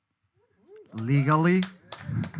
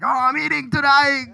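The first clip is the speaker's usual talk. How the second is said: excited; 10 semitones higher, 5 dB louder than usual. Retold or imitated. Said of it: "I was like".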